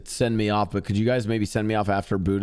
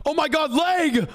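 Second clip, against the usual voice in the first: panicked yell; slower than usual. Acted. Sudden injury in the scene.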